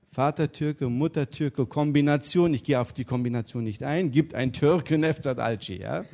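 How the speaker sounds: normal talking voice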